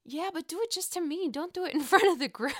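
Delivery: giggly quote, pitch up; retelling a friend's line amused; laughing while quoting. Retold or imitated. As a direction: quote her through laughter. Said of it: "Giselle's like".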